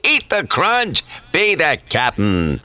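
hearty cereal captain; Captain Crunch voice. Shown as a demonstration. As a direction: announce it like a cartoon captain. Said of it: "Ed Kelly, Captain Crunch".

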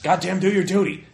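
loud, high-pitched command; indignant officer's outburst; exasperated order. Acted. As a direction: shout them back to their duty. Acted order to reluctant underlings.

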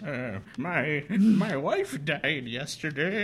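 put-on frail old man asking for help; creaky old-timer voice. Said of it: "a frail old man".

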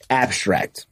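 ordinary talk

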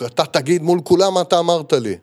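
voicing the angry man shouting at the circle; retold shouting. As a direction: quote his angry demand loudly. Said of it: "ומתחיל, אה, לצעוק".

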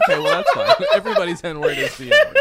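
real laughter breaking the line; laughing. Real, not acted. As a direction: squeal the punchline while cracking up. Laughing at own joke.

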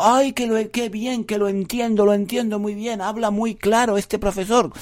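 high, pleased listener voice; a new listener gushing with relief; mock delighted new listener gushing. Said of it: "estarás pensando".

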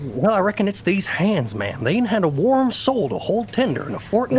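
put-on Western cowboy accent. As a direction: play a romantic cowboy. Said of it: "That's a cowboy voice".

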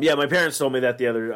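normal talking voice

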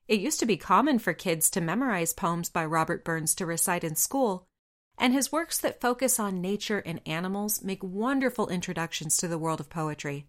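normal talking voice

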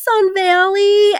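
very high, slow, drawn-out; sing-song it carelessly; airy mock answer. Heroine answering Josh on the phone, high and drawn-out.

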